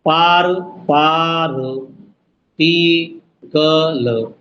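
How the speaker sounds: पार- and टी-कल pulled apart slowly; syllable split. Shown as a demonstration. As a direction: break the word into beats. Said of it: "पार्टिकल". (पार्टिकल के टुकड़े धीरे-धीरे)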